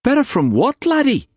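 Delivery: very high, anxious questioning; alarmed question. Acted. Uncle presses his pale nephew, alarmed. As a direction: press for an answer with rising alarm.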